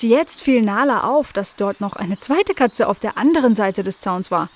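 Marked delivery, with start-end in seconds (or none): none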